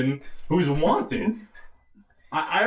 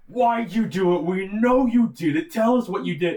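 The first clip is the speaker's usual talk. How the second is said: raised, higher-pitched mock interrogation yell; acted-out angry yell, louder and higher; imitated yelling; 7 semitones higher, 4 dB louder than usual. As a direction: play an agent yelling accusations at a suspect. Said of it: "the border agent is yelling at him".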